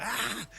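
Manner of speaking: high-pitched imitated yelp of excitement; high mock excited shout. Retelling the kid finding a key, mock excited shout. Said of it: "just shouts 'cause he's so excited".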